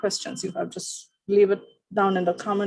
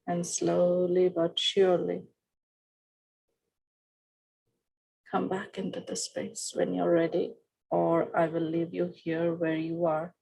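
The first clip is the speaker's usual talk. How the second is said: soft, slow body-scan meditation voice; guided relaxation; 2 semitones lower, 4 dB quieter, slower than usual. Acted. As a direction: ease them down into calm, fading softer. Leads a breathing relaxation.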